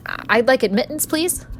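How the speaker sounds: a nervous stammer rising high on please. Acted. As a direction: ask for admittance, nervous and halting. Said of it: "I stammered".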